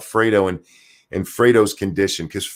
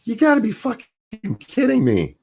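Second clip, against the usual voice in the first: incredulous quote; 13 semitones higher than usual. Retold or imitated. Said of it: "people on Twitter was like".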